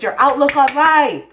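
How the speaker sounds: very loud, insistent outburst; playful full-voiced shout; emphatic yell. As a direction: belt it out as a forceful reminder. Yelling a card's message.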